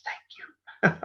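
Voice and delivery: unvoiced whispered thank you. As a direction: lean in and breathe a grateful thanks. Mom leaning over to Santa.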